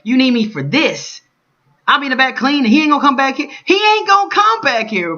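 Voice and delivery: high, loud, pleading outcry; exasperated rant. Retold or imitated. Vlogger voicing his inner argument with the boss, exasperated. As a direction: push it up high and loud, fed up. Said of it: "That's how I'm feeling".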